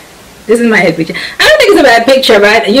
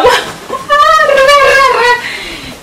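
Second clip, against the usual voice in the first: high delighted laugh, drawn out; real joyful laugh; giddy laugh. Real, not acted. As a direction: laugh with happy relief. Ends on her photo.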